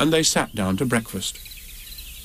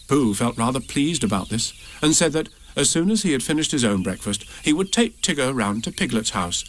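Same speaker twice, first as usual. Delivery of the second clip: usual speaking voice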